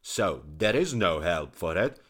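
loud, forceful royal tirade; angry king. Acted. King telling his courtiers why the physician dies, loud and angry. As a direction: insist the traitor must die. King justifies killing, shouting.

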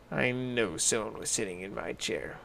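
a knowing, hushed Mama Bear; mother bear confirming quietly. A narrator's reading. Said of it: "said Mama Bear".

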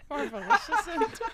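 high, laughing echo of the word; laughing mimic. Mocking friend's word.

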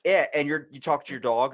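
normal talking voice

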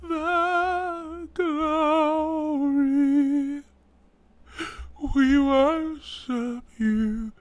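very slow, high, drawn-out chant; mock worship leader. Worship leader at the mic, slow, high intoning. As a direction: stretch every word in a high, hushed reverence. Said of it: "And he goes".

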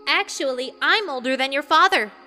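mildly irate retort; flat correction. A narrator's reading. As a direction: correct her firmly. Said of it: "Saria told the Zora flatly".

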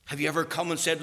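ordinary delivery

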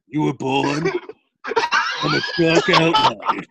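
a hint of a gravely serious movie villain; mock ominous line. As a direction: deliver it like a solemn threat. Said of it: "Kevin would say it like".

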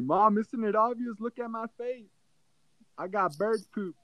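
high, upset whine; whiny kid. Retold or imitated. Son showing mom the bird poop, high whining. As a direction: complain like a hurt little boy.

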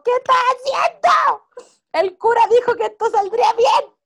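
Julieta waking up, alarmed and high; shocked Julieta. Retold or imitated. Julieta, startled on waking, asks what they did. Julieta wakes and confronts them.

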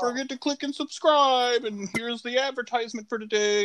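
mock a cheesy YouTuber greeting; YouTuber intro voice. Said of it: "It's like".

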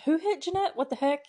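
high-pitched indignant questions; mock outraged surprise. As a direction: imitate a shocked mom. Voices the mom's shocked reaction.

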